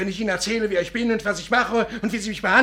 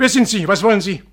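sharp, louder alarmed questions; 7 dB louder than usual. Acted. Norton confronts the woman at his door, alarmed and loud. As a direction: challenge the stranger at the door.

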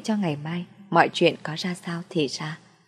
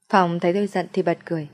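usual speaking voice

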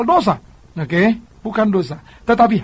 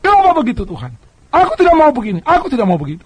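complaining to God; 6 semitones higher than usual. Retold or imitated. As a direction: protest to God like a frustrated believer. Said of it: "jangan sampai saudara mempersalahkan Tuhan".

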